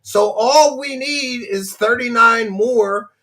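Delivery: high, loud pleading pitch; hammed-up plea to keep the streak; mock begging streamer. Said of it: "I gotta adopt that strategy".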